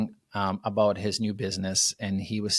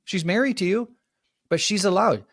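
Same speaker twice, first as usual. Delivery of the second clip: emphatic insistence; 9 semitones higher, 3 dB louder than usual. Retold or imitated. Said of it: "I said".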